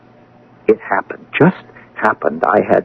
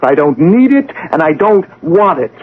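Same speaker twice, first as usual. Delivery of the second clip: higher, firmer rejection; angry refusal; 7 semitones higher than usual. Acted. Son refusing his father's praise, raised and higher. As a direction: throw the praise back at him.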